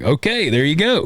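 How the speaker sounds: a casual told-you-so, tossed off; mild mock resignation; mock shrug. Mock countries complying.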